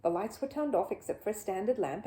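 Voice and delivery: ordinary delivery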